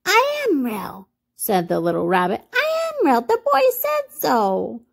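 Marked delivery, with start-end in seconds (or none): 0.0-1.1 high, slow toy-rabbit protest; high, drawn-out storybook protest; protesting little rabbit. A narrator's reading. 2.5-4.8 very high little-rabbit protest; plaintive, drawn-out storybook plea; close to tears. A narrator's reading. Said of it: "nearly began to cry".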